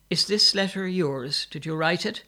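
accusing. A narrator's reading. Brother confronts sister with letter.

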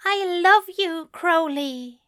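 high, pleading declaration of love; plaintive. A narrator's reading. Angel declares love to his demon, plaintively. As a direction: plead it high, falling away on the name. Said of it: "Aziraphale says plaintively".

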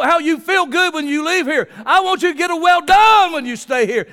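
loud, high preacher's cry; full-force preaching. Acted. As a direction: preach it out with fire to the congregation.

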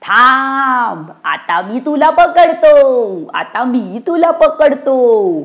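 slow, stretched-out spook threat; weird ghost voice. Acted. Ghost threatens to catch the children, weird slow ghost voice. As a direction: wail it eerily, dragging out every word. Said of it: "चित्रविचित्र आवाजात अंबिया भूत बोलत राहिलं".

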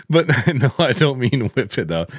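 laughing denial. Real, not acted. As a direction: giggle through the correction. Laughing at the double meaning.

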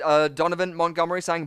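ordinary delivery

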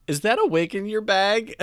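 high, loud imitation of a woman yelling; retold yell. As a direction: call it out like a nosy stranger across a lobby. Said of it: "I hear this woman just yell".

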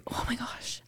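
breathless whispered aside; whispering. Real, not acted. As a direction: whisper it in awe. Awed aside about the coast.

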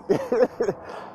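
brief real laugh to himself; short chuckle. Real, not acted. Speaker amused by likening school to the street, short chuckle. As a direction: chuckle lightly after your own line.

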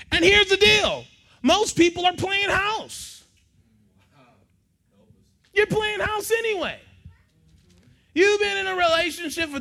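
loud, high preaching voice. Acted. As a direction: preach it out to the crowd. Preaching at full force.

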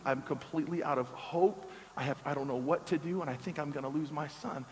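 soft, slowed, heavy-hearted confession; hushed grieving mother. Retold or imitated. Single mom confessing despair over her son to the pastor, hushed and sad. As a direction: let each worry sink low and quiet.

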